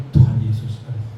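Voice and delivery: low, loud dismayed "Tuhan Yesus"; a low groan of realization; wife's dismayed gasp. Retold or imitated. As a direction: let it out like a wife who just found the key. Wife's dismayed exclamation on phone.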